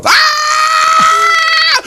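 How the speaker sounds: long, loud, very high shriek; scream of pain. Acted. Fox's paw caught in trap, screaming. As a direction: shriek as the trap snaps shut.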